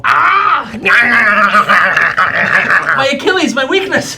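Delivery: loud, high laughing yelps of pain; hammed-up ouch with laughter; mock pained laughing shout. Bitten on the ankle, playful pain.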